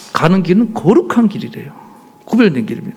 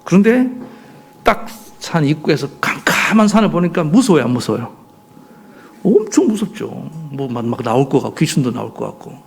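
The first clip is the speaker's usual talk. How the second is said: normal talking voice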